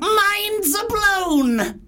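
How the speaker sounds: very high, drawn-out squeal of amazement; piercing mock squeal. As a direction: squeal it slowly, hammed up. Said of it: "that really goes through me".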